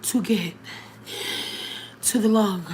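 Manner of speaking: acting out gasping for air between words; halting, breathless reply with long gaps; winded. Winded woman answering a stranger who stopped, imitated gasping. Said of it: "I'm winded at this point".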